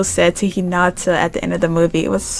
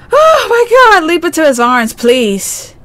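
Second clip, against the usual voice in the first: high, loud, thrilled oh my god; squealing as the couple reunite; excited squeal; 8 semitones higher, 5 dB louder than usual. Real, not acted. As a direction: shriek with delight.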